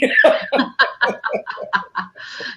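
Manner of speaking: long airy high laugh. Real, not acted. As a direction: let a long laugh roll out.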